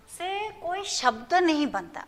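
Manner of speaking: ordinary talk, nothing marked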